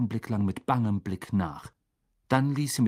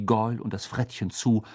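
ordinary delivery, nothing marked

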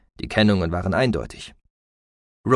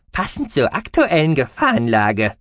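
high squeaky mouse-beaver voice; piping; 5 semitones higher, 4 dB louder than usual. A narrator's reading. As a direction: pipe it up high like a small furry creature. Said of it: "piepste er".

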